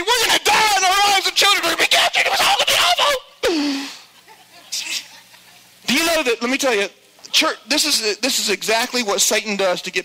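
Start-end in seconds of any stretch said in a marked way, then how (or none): 0.0-3.9 high, frantic hammed-up panic; mock panic. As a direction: play it as a comic meltdown of doom. Spies panicking by day forty.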